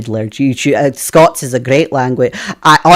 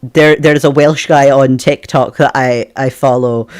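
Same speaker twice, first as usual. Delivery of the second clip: usual speaking voice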